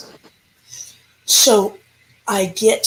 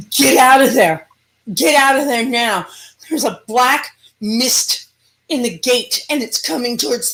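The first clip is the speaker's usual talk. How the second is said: raised, higher pleading to get out now; urgent warning; 5 semitones higher than usual. Retold or imitated. Team member on voicemail warning Jimmy, urgent raised plea. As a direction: urge him out fast, voice pitched up with alarm.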